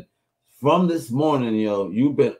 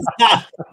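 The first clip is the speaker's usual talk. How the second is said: loud laugh; 10 semitones higher, 7 dB louder than usual. Real, not acted. Laughing at the story.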